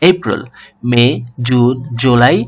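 usual speaking voice

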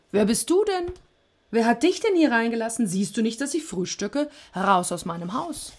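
loud, high, indignant yelling; bellowing. A narrator's reading. Sisyphos at breakfast throws out Death, bellowing. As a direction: roar at an intruder. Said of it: "Stattdessen brüllte er".

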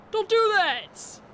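high, loud, slow frightened shout; real fright after a jump scare; startled. Real, not acted. Player yelling at the game after a scare, frightened.

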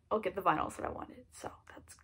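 quick whispered aside; breathy confiding whisper to the viewer. Real, not acted. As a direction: drop to a whisper, sharing a secret. Aside to camera after vinyl list.

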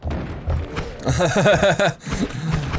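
high burst of laughter. Real, not acted. Watching an enemy get ripped.

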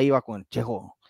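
ordinary talk

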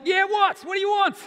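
acted high, loud irritated shout; exasperated dad. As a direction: snap back at a pestering child at full volume. Exasperated dad snaps at nagging child.

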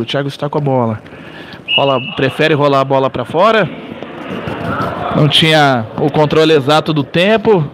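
steady match narration, low and even; live play-by-play. Acted. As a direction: call the action as it happens.

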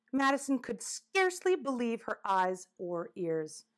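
plain reading voice

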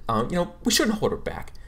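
flustered counselor over-explaining; bumbly teacher. Acted. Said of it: "she's like".

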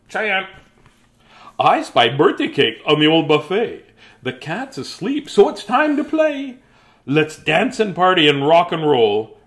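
lively, slow storybook reading; reading to children. Acted. Teacher reading a birthday picture book, lively and slow. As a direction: read it out big for the kids.

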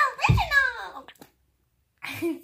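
very high, loud squeal; high squeaky voice. Acted. Character impression game.

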